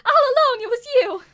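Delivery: loud, outraged accusation; shocked accusation. Acted. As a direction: realise the betrayal and yell it. Maid accuses the villain.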